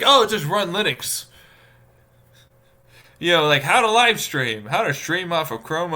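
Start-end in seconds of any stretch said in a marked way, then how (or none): 0.0-1.2 mocking glib advice.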